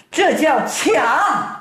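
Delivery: high, slow emphatic accusation; storyteller's aggrieved character; indignant accusation. A narrator's reading. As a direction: drag out the charge in high-pitched outrage. Robbed man accuses in quarrel.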